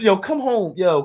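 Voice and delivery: ordinary talk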